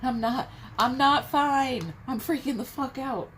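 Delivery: panicked shout. Acted. Tarot reader voicing the energy that is losing it, panicked shout. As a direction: let the panic burst out.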